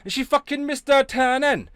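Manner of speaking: high, loud put-on Welsh accent; sing-song Welsh gossip, pitched high. Shown as a demonstration. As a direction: lay on a thick, indignant Welsh accent. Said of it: "That's Welsh".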